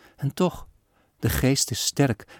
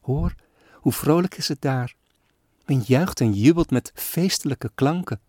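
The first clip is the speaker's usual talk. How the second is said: put-on higher wistful little girl voice; longing child; 4 semitones higher than usual. Sick girl tells father of the joy outside, higher and longing. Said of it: "riep zij".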